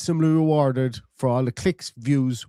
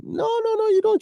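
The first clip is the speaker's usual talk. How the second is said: much higher sing-song denial; mocking rebuttal. Real, not acted. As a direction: scoff back at the pundits. Retort to pundits' claim.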